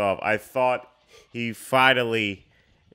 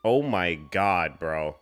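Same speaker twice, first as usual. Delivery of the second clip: stunned disbelief; 3 semitones lower, much slower than usual. Real, not acted. Stunned by what appears on screen.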